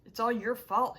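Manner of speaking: loud, accusing outburst; angry accusation. A narrator's reading. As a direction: throw the blame hard. Rowdy blames the narrator in anger.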